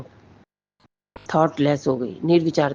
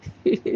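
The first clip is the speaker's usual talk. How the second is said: short real laugh. Real, not acted. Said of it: "मैं हंस रही हूँ".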